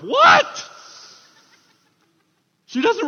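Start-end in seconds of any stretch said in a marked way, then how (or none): 0.0-0.7 loud, high, hammed-up disbelief; shocked outburst. Mary reacts to being told she will conceive, shocked. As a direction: burst out in mock shock.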